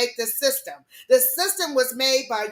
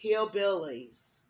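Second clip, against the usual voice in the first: softer, slow, falling "Hillbillies."; quiet repeat; 4 semitones lower, 7 dB quieter, slower than usual. Shown as a demonstration. Repeating her point to viewers, soft and slow. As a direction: drop your voice and let "Hillbillies." sink slowly. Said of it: "what, I say".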